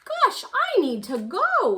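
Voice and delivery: startled. Acted. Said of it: "he said".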